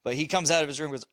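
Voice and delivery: ordinary talk, nothing marked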